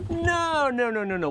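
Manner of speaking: high, rushed no no no; panicked denial. Acted. The governor denying the bridge to the cop, panicked. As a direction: deny it frantically.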